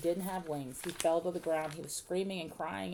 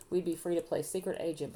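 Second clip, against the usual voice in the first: plain reading voice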